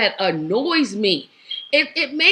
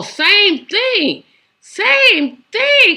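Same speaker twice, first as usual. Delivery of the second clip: high, climbing louder on the repeat; exasperated outburst; 9 semitones higher, 6 dB louder, much slower than usual. Real, not acted. Commentator faulting another politician for the same thing, exasperated. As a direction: exclaim it with mounting exasperation.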